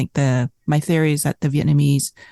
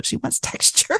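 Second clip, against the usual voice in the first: laughing; 12 semitones higher, 4 dB quieter than usual. Real, not acted. Laughing while describing mom.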